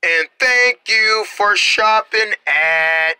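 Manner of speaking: hushed, drawn-out commercial voice. Acted. Reads a slow commercial.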